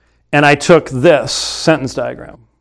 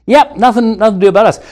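mock dismissive; 6 semitones higher, faster than usual. Mocking opponents' dismissive view.

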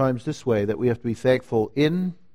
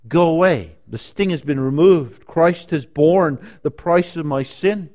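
loud, raised-pitch preaching; defiant address to death; 6 semitones higher, 8 dB louder than usual. Acted. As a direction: command death away from the pulpit, building in force. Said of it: "We say, "Death, go away".

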